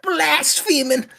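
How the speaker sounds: high, strained preacher voice; Robert Pattinson impression. Doing Pattinson as a ranting preacher. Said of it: "And he's literally going".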